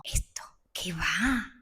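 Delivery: soft, slow, shy whisper; modest whisper. Retold or imitated. Brushing off a friend's compliment on earrings, whispered. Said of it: "Y yo digo".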